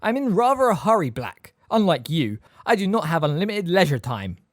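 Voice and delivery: high, loud disdainful sneer. A narrator's reading. Snape tells Sirius he is busy, sneering. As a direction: brush him off with contempt.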